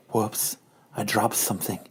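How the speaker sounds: seduce him in a hushed low voice; seductive Madam Hydra. Acted. Madam Hydra flirts with Hank in a low purr. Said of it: "Madam Hydra knocks a pen".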